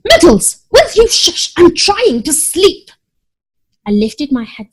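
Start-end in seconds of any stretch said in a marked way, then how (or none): 0.1-2.9 higher, louder irritated snap; cross at being woken; grumpy sibling told to hush; sleepy annoyed. A narrator's reading. Cat woken at night.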